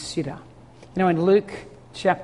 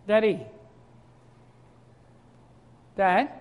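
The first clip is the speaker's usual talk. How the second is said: put-on softer, lost child calling; almost giving up hope; quiet worried calling; 2 semitones higher than usual. Boys losing hope of finding dad, quiet worry. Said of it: "They started to panic".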